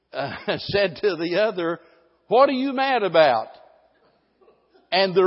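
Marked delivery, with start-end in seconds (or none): 2.3-3.5 higher, louder prodding question; pushy, raised what are you mad about; suspicious question. Retold or imitated. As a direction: call out to your sulking spouse. Spouse asks partner why mad.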